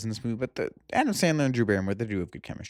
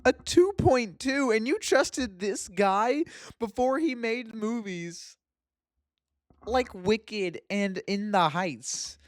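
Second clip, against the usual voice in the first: high, slow incredulity; appalled disbelief; 8 semitones higher, slower than usual. Real, not acted. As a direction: stretch it out in high-pitched dismay. Appalled at the low film score.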